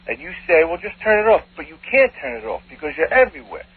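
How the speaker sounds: ordinary talk, nothing marked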